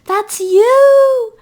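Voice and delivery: very loud, high, long-held squeal; excited squeal. A narrator's reading. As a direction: shriek it with glee, holding the last word. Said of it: "May squealed again".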